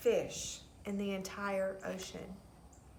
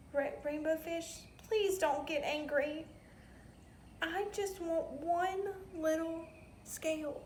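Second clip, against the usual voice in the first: high, small, hesitant voice; little blue fish pleading timidly; 7 semitones higher than usual. Acted. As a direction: ask shyly and carefully.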